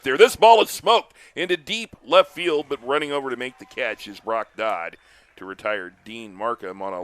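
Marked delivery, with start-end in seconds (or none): 0.2-2.7 louder, higher call as the ball flies; excited call. Real, not acted. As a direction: rise with the hit, calling it loud. Home run call on a deep fly.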